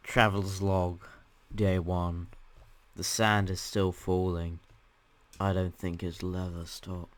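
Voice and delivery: low, slow, weary log-keeper; record it slowly and low, as if stranded; mock traveller's log. Stranded player logging the endless falling sand, mock-solemn slow voice.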